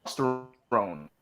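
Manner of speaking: slow American testosterone, stroNE drawn; American pronunciation, slow and low; American accent. Shown as a demonstration. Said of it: "Americans say".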